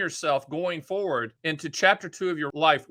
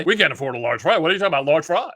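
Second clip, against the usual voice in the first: louder, exasperated parental rebuke; scolding father; 4 dB louder, faster than usual. Retold or imitated. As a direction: play the penny-pinching dad who is appalled. Father scolding son over fries.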